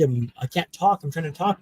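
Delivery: ordinary talk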